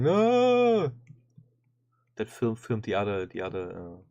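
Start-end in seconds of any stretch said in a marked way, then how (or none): 0.0-0.9 high panicked yell of a bystander; bystander screaming no. Retold or imitated. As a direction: shriek it like a panicking onlooker. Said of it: "an idiot from the sideline is going".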